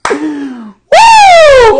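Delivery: a high, loud whoop; exasperated whoop. Real, not acted. Reacting to the commentary with a high loud whoop. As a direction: let out a high exasperated woo.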